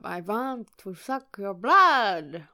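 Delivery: put-on slow Dracula accent, drawn out; vampire voice. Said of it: "I wanna do a vampire voice".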